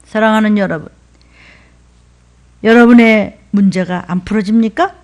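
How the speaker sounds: ordinary talk, nothing marked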